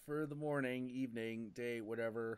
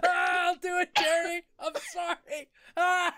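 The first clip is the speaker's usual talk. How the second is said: mock terrified yelping, much higher and louder; panicked office worker; 9 dB louder than usual. Office worker tackled in the commercial, hammed-up yelps. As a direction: shriek like someone flattened by a linebacker. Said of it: "Terry Tate in that commercial".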